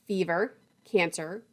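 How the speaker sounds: normal talking voice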